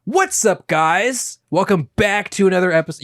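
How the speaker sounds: hammed-up intro, punching certain words; try-hard YouTube voice. Shown as a demonstration. Host demonstrating a pet peeve, try-hard YouTube voice. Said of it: "what the YouTube voice is when you're trying too hard".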